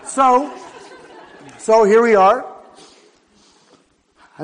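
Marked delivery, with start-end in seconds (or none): 0.0-2.5 laughing. Real, not acted. Laughing at his own story.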